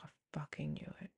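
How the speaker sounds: hushed, breathy whisper of dawning certainty; whispered shocked aside; whispered realisation. Retold or imitated. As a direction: whisper it like a secret you always knew. Recalling instant realisation.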